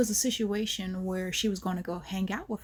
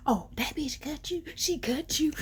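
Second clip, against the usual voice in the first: friends' voice; 6 semitones higher than usual. Retold or imitated. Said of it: "if you ask any of my friends".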